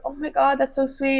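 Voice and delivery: high-pitched imitation of a grateful friend; mock-gushing, lifted voice; touched gushing. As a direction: gush as if handed a gift, imitating her. Acts out grateful friend's reaction.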